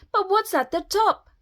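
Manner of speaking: high-pitched eager question; excited cry. A narrator's reading. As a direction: wonder aloud, high and keen. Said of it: "Jack cried".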